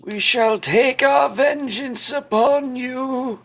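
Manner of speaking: high, slow ghostly threat. Acted. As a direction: wail the curse like a vengeful spirit. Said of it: "Let's hear your best ghost voice acting".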